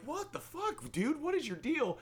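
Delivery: very high exasperated shout; high-pitched incredulous retold yell; retold outraged yell. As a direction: demand it of the aggressor. Retold confrontation, yelling.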